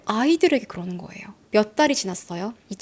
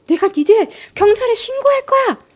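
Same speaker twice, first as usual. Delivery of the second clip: much higher, much louder yelled threat; angry threat; 11 semitones higher, 6 dB louder than usual. Retold or imitated. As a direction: shriek the threat at the kids. Acted neighbor threatening kids.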